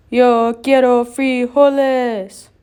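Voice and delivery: slow, loud bandit growl; menacing giant bandit. A narrator's reading. Bandit's demand.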